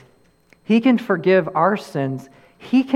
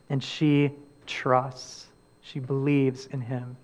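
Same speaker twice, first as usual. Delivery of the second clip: much softer and slow, almost intimate; hushed; 4 semitones lower, 10 dB quieter, slower than usual. Acted. Pastor closing Mary's story gently, hushed. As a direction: let it fall to a hush.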